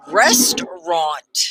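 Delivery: restaurant said slowly as RES-trant, middle syllable dropped; model word slowly. Shown as a demonstration. Teacher modelling restaurant for the class to repeat. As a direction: model restaurant for the learner to repeat, slow and clear. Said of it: "Let's say the word".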